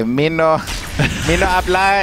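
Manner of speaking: playful mock Spanish accent; put-on Spanish accent. Caster introducing himself in a hammed-up Spanish accent. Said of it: "What's the accent for?".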